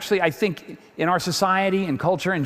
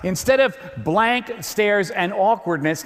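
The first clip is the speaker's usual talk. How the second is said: normal talking voice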